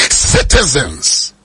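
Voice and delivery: Citizens stretched slowly with a fall; slow, drawn out. Shown as a demonstration. Preacher to congregation, stretching Citizens slowly. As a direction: let Citizens hang slowly.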